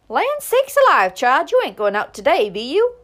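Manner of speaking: hands on hips, shocked at her daughter; amazed outburst. A narrator's reading. Mother scolding daughter going out in snow, loud amazed cry. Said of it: "in her usual attitude of amazement or wrath".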